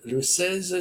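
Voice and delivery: ordinary delivery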